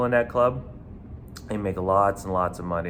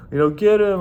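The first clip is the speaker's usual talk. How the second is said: louder, much higher mock cheer; crowd shout; 10 semitones higher, 7 dB louder than usual. Onlookers cheering on the bouncer, loud high shout. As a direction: egg him on from the crowd.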